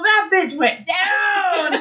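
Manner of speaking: high, drawn-out exclamation; gleeful mock shout. Witness retelling her friend's fall to the room, gleeful shout.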